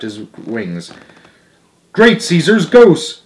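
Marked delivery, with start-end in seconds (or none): 1.9-3.2 loud, slow astonished exclamation; astonished cry. A narrator's reading. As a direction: gasp it out in amazement. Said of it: "cried the teacher".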